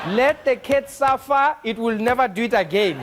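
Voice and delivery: deliberate, unsympathetic operator verdict; stern operator. Acted. Operator refusing to help with the cat, stern. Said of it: "They'd be like".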